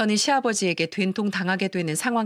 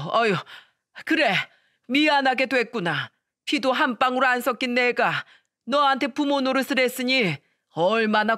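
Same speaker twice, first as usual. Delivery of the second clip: stunned bitter father; 4 semitones higher than usual. Retold or imitated. Stunned father-in-law, bitter reply.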